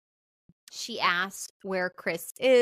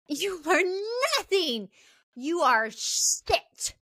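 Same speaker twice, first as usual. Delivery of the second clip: higher, slow scornful "jealous? jealous?"; scornful disbelief; 8 semitones higher, slower than usual. Retold or imitated. Nancy mocking Chris, scornful high sneer. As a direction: sneer at him with contempt. Nancy furious, acted quote.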